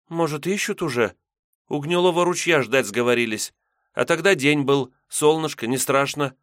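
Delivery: put-on high, wistful young girl. Maiden about her friends.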